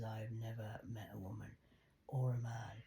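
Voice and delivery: whispered, voice dropped low; half-whispering it like a secret; hushed confiding. Retold or imitated. Dad confides his daughter's strength, whispering.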